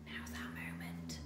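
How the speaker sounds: hushed sneaky whisper; sneaky hiss. A narrator's reading. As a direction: whisper it like a plotting thief. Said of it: "hissed Shifty".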